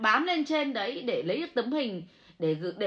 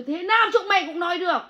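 higher, louder, fast angry retort; indignant outburst; 7 semitones higher, 5 dB louder, faster than usual. Real, not acted. Monk lashing out at online critics, fast angry outburst.

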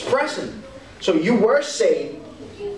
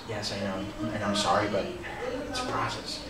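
very soft, low apology; acted hushed, contrite confession; humble admission; 9 semitones lower, 10 dB quieter than usual. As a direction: murmur it to yourself, apologetic. Acts out quiet admission to oneself.

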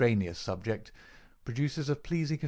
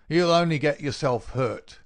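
loud, higher police warning; inspector's sharp warning; 5 semitones higher, 7 dB louder than usual. Acted. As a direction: raise your voice to restrain a struggling prisoner. Inspector restrains a struggling prisoner.

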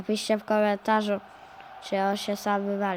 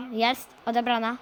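usual speaking voice

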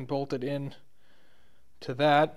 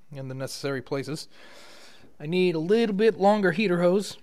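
ordinary talk

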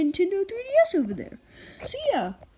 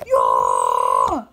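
deep shout; 7 dB louder than usual. Acted. Cheering after subscribe.

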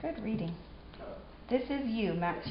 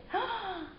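sharp delighted gasp, high; excited gasp; 12 semitones higher, 7 dB quieter than usual. Real, not acted. Excited gasp at child's reading.

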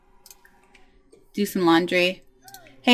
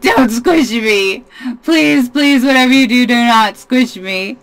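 scared puppy voice; 5 dB louder than usual. Retold or imitated. Puppy begging the toddler holding it, mock pleading. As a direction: beg not to be squished, hammed-up and loud. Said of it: "He's just like".

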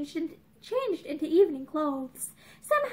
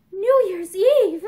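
louder, slow startled echo of his words; 2 semitones higher, 9 dB louder, slower than usual. A narrator's reading. The girl echoes Mickey's mention of the date, loud and slow. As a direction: let the words land loud and slow, caught off guard. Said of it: "she echoed".

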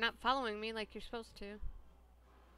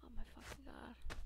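whispering; 9 dB quieter than usual. Real, not acted. Whispered reaction.

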